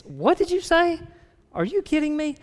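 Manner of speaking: very high-pitched, squeaky disbelief; incredulous response. Shown as a demonstration. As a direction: react as if it makes no sense. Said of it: "there is no validity whatsoever".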